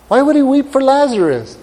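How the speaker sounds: high-pitched, incredulous question; puzzled questioning. Acted. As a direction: ask it high, as if it makes no sense. Preacher voices his own puzzled question.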